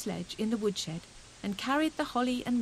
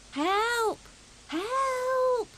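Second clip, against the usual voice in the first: much higher, muffled squeak; tiny faraway shriek; 14 semitones higher, 4 dB louder than usual. A narrator's reading. Fuzzy Peg stuck in the snowball, small shriek. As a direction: squeak from far inside. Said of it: "shrieked the tiny, faraway voice".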